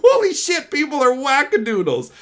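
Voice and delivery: high, loud mock disbelief; mock astonishment. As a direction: gasp it out in disbelief. Astonished reaction.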